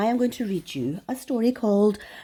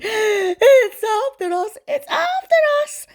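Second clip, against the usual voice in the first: very high, slow, wailing cry of terror; hammed-up terrified wail; panicked shouting; 14 semitones higher, 4 dB louder, slower than usual. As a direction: flee screaming it's after us.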